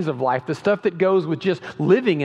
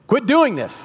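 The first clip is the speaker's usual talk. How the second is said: higher, louder scoffing command; mock order; 7 semitones higher, 5 dB louder than usual. Preacher mocking the leaders' ban, scoffing command. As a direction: sneer their order back.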